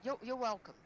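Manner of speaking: eager you're welcome. Shown as a demonstration. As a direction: hurry to say it right. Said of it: "Just say, 'You're welcome.'".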